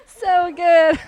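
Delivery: loud, high fake amusement; forced laugh. Server faking delight at a customer's joke, loud and high.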